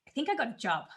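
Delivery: laughing disbelief. Retold or imitated. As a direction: say it through a giggle, hardly believing it. Telling husband on the phone.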